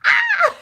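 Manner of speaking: short, very high, loud mock scream; sudden high-pitched shriek; little scream. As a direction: squeal like a startled toddler. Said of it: "do a little scream".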